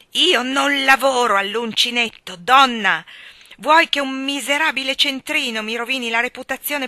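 loud furious roar; exploding in rage. A narrator's reading. Cyrus exploding at his wife over the doily, furious shout. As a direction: blow up at your wife. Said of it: "infine esplose".

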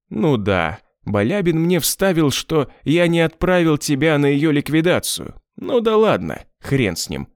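plain reading voice

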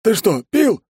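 high excited cheerful voice; Ben's voice. Acted. Ben sniffs, asks suspiciously.

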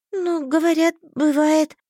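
put-on high, naive girl hopefully; young girl voice. A narrator's reading. Heroine answers.

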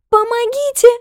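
very high, loud scream; cry for help. A narrator's reading. Girl being dragged toward the yard, screaming. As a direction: scream out for rescue. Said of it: "закричала она".

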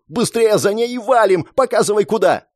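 high, pressed shouted orders; urgent shouting. A narrator's reading. Girl urges ally to run, high urgent shouting. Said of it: "продолжала кричать она".

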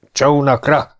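shout. A narrator's reading. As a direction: call out to the boats. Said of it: "kiáltott egy hang". (kiáltás a csónakokhoz)

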